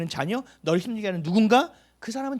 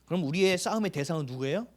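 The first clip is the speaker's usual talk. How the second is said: usual speaking voice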